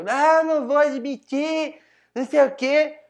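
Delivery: loud, high mock indignation; imitated angry outburst. Man imitating the speaker who told him to shut up, angry mock yell. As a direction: imitate an outraged man shouting someone down. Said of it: "começou a mandar eu calar a boca".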